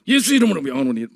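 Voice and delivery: a hint of a stern exorcism command; forceful mock prayer. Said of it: "뭐 세게 뭐 나하면 될 줄 알고".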